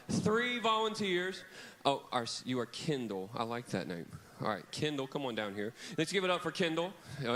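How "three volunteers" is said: calling for volunteers. Real, not acted. Asking kids for volunteers.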